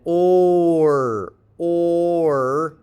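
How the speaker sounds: "or" held very long; isolated sound. Shown as a demonstration. Teacher isolating the vowel in Coral, drawn-out "or". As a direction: stretch "or" out as one sustained sound.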